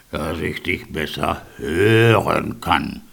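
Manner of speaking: low, slow wolf voice. A narrator's reading. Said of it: "sagte der Wolf".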